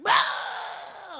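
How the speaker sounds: sudden "Ah!" on impact, loud and high; pained yell. Acted. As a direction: yell as the blow lands. Character hit by the blast.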